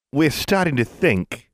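Mister Johnson's voice. Acted. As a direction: lean in with a veiled threat.

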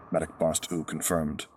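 plain reading voice

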